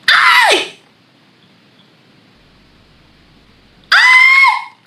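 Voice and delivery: very high shouted aïe; shouted ouch. Real, not acted. Watching the live and crying out very high and loud. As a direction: cry out in mock pain.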